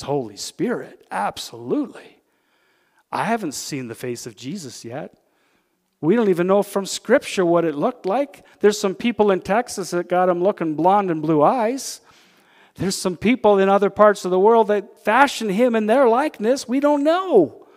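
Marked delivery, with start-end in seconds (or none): 6.0-17.7 loud, high preacher's exhortation; preaching at full force. Acted. Preacher rousing his congregation, loud full-force preaching. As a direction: lift the room, preach it out.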